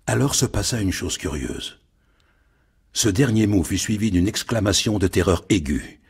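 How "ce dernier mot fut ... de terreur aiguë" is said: ordinary delivery, nothing marked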